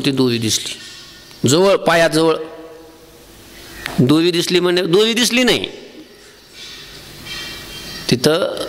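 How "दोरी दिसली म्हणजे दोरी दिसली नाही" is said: ordinary talk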